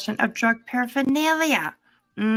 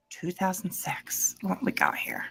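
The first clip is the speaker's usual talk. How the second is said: hushed What we got here, breathed with no voice; whisper; 3 semitones lower, 7 dB quieter than usual. Acted. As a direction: whisper it like a secret. Reading rap sheet aloud.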